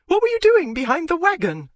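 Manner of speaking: very high, loud furious demand; angry demand. Acted. Wife demanding answers from husband, furious. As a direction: demand it at full pitch.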